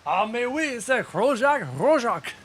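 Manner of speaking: high, hammed-up French voice; mock French accent. Streamer naming his character in mock French. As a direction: roll it out like a cartoon Frenchman. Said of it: "That's horrible".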